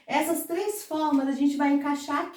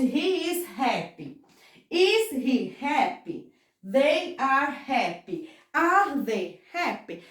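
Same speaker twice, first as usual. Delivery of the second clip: slow, clear classroom model; slow and careful; slower than usual. Shown as a demonstration. Teacher modelling the sentences for the class, slow and careful. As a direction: say each model sentence slowly. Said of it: "também funciona pra todos os nossos outros pronomes".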